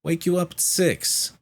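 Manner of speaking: high, loud mock exasperation; exasperated retort. Nurse telling a patient it's two thirty A M, exasperated.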